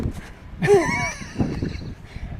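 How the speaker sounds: high, breathless laugh; real laughter; big laugh. Real, not acted. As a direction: crack up at the memory. Laughing at own story.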